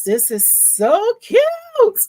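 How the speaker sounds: high, slow, laughing gush; laughing delight. Real, not acted. Embroiderer laughing to viewers over the cute shirt.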